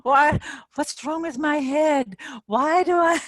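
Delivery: high, loud, frantic whining at herself; mock panicked self-criticism; frantic self-blame. A woman mocking her own anxious inner voice, high and frantic. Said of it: "So don't go".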